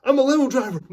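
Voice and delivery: very high, loud quoted shout; high-pitched comic outburst; hammed-up catchphrase yell. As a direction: holler the movie line with goofy confidence. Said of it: "I always put that line".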